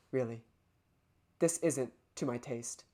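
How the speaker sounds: low, soft, condescending purr; low villainess voice. Acted. To Yagumo, tempting him: low, soft villain voice. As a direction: tempt him gently from far below. Monomi voice again.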